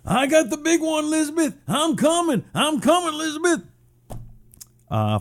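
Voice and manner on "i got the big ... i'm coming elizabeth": much higher, put-on old man clutching his chest; hammed-up mock heart attack cry to Elizabeth; Red Sanford impression. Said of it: "you know, a Red Sanford".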